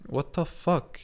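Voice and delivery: high-pitched retold bafflement; exasperated disbelief. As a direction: protest it as if you can't believe it. Retells his exasperated reaction to her.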